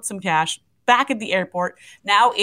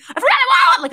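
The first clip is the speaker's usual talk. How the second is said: very high, louder, frantic squeak; squeaky panic pitch; 6 dB louder than usual. Retold or imitated. Calling her husband in a very high panicked squeak. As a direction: squeal it up where only dogs could hear. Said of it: "I'm talking to a pitch only dogs can hear".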